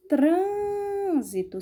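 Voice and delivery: "Trânsito" with TRÂN drawn right out; stressed syllable. Shown as a demonstration. Teacher points out the tonic syllable, TRÂN stretched in "Trânsito". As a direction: hold the closed TRÂN of "Trânsito", then release. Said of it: "a sílaba tônica".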